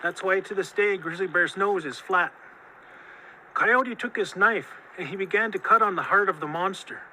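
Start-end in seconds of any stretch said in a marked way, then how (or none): none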